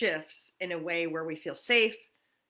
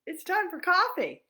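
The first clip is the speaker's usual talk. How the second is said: excited announcement; 11 semitones higher than usual. Real, not acted. Morning coffee announcement to viewers.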